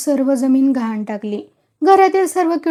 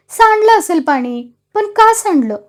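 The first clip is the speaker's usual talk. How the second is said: higher, louder irritable yell; irritated shout; 7 semitones higher, 4 dB louder than usual. A narrator's reading. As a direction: snap it back in an irritated shout. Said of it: "तुका चिडक्या आवाजात ओरडला". (चिडक्या आवाजात ओरडणे)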